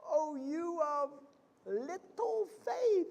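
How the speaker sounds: drawn-out gentle rebuke; Jesus chiding Peter. Retold or imitated. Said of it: "remember what Jesus said to Peter".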